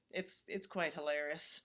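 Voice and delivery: ordinary talk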